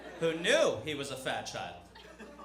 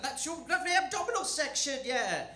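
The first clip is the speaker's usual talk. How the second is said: gushing British mother; 8 semitones higher than usual. Retold or imitated. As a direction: talk it up, ever more emphatically. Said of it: "this little British liar".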